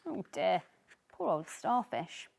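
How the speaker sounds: usual speaking voice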